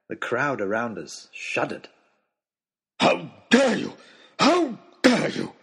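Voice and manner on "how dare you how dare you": high, loud, outraged roar. A narrator's reading. Oxshott turns on Nicholas in outrage, roaring. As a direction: roar it in slow, furious bursts. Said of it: "roared Oxshott".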